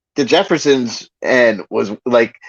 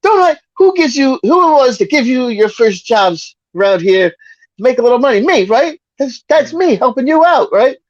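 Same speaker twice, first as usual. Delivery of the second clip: high, blustering Archie Bunker bit; 11 semitones higher than usual. Retold or imitated. Archie reminding Lionel he gave him jobs, high blustering. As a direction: puff up like the bigoted dad, pitched up. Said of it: "He's like".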